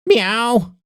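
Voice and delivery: high meowing; cat meow. Acted. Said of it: "The automated voice ... blares".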